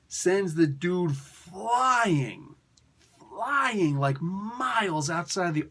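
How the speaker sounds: drawn-out excitement. A narrator's reading. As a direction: stretch out the flight for effect. Shockwave blasted far away.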